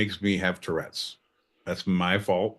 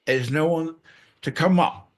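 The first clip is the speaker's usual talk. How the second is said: ordinary talk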